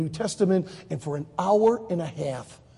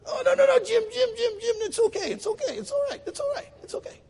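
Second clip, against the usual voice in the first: very high voice, repeating reassurances; flustered placating. Retold or imitated. Israeli pressed again about laughing at Jim, flustered placating.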